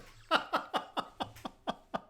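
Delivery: very high, wheezy laugh; real laughing fit; helpless laughter. Real, not acted. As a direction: laugh till the voice gives out. Still laughing at the impression.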